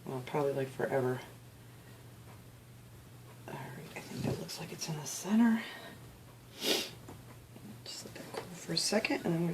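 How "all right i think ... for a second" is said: softer whispered self-talk; concentrating whisper. Real, not acted. Crafter centering the tea light holder, whispering. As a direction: whisper while lining it up carefully.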